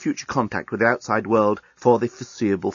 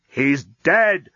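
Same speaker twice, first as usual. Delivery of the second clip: louder, forceful yell; 3 semitones higher, 4 dB louder than usual. A narrator's reading. Leovinus yelling back at the accountant, forceful. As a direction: bark it back at him. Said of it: "yelled Leovinus".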